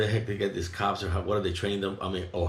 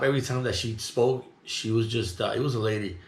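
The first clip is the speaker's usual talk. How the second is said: normal talking voice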